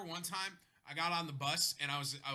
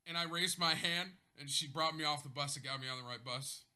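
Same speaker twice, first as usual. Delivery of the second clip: normal talking voice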